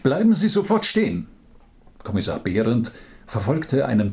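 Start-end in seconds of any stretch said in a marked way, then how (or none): 0.0-1.3 sharp, high shout to stop; shouting command. Acted. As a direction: call out to halt the suspect. Chasing a suspect, calling out. (laute Aufforderung)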